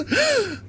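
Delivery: high excited gasp; sudden delighted intake of breath. Real, not acted. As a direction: gasp at a lovely surprise. Excited at seeing the bird.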